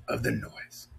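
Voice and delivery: usual speaking voice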